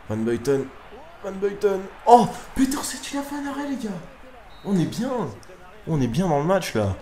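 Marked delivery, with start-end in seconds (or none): 1.2-1.8 nom lancé nettement plus aigu; voix qui grimpe avec l'action; appel excité. Real, not acted. Second appel, l'action chauffe. 2.1-6.7 cri très aigu et étiré; exultation. Real, not acted. Son gardien fait enfin un arrêt.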